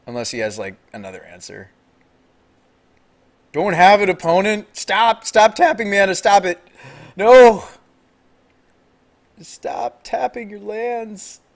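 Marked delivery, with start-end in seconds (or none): 3.5-7.6 high, loud yelling at the opponent; frantic loud pleading. Real, not acted. Pleading loudly as opponent taps mana. 9.4-11.4 slow, high-pitched begging; stretched, high wail of protest; whiny high plea. Real, not acted. Pleading as opponent keeps tapping.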